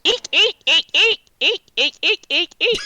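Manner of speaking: loud, very high-pitched string of eeks; high squeaked eeks. Acted. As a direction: squeak out a creature's message in short loud bursts. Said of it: "It says".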